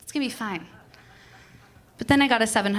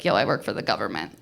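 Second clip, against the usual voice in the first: much lower blunt guy voice; gruff postman voice; 12 semitones lower, faster than usual. Retold or imitated. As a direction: drop low and deadpan like a bored postman. Postman quoted.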